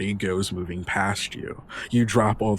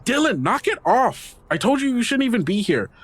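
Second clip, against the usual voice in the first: put-on much higher girl's voice, scolding fast; scolding cashier girl; 4 dB louder than usual. Register girl scolds Dylan for causing trouble, high and fast. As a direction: tell him off like an annoyed clerk. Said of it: "the girl from the register turn and go".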